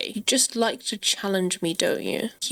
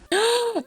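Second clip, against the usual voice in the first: high, sharp gasp of surprise; excited gasp; 12 semitones higher than usual. Real, not acted. Gamer seeing an important call come in, excited high gasp. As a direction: gasp as if big news arrived.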